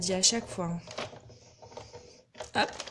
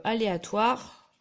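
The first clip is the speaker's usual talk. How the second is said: usual speaking voice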